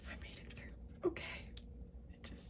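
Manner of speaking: barely audible aside to yourself; relieved whisper. Real, not acted. Reader finally through the line, relieved whisper.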